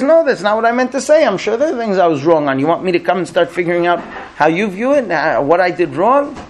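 high-pitched husband pleading his case; acted exasperated backtracking; frustrated protest. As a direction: start high in exasperation, then ease slightly. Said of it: "He's frustrated".

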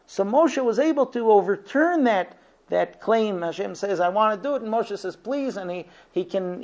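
rising to a passionate pitch; impassioned preaching. Acted. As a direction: drive the point home like a fiery sermon. Raises voice in impassioned teaching.

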